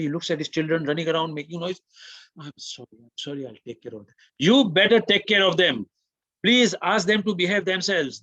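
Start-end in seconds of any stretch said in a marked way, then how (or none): 2.4-4.1 very soft, subdued apology; dazed apology. Retold or imitated. Dazed father promising to take care of his children, hushed apology. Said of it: "in, in a, in a sleep". 4.4-8.2 loud, raised-pitch retold scolding; worked up, angry. Worked-up passenger ordering the father to control the children, angry. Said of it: "he was very worked up".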